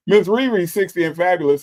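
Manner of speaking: high, loud, happy name call; excited shout-out. Real, not acted. Streamer hyping a viewer's name, excited. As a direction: announce her like a star.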